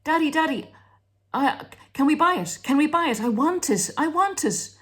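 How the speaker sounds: put-on high, pleading little child voice; child begging parent for a shiny toy car, whiny and high. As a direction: beg like a kid at the toy shop. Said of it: "when a child... say".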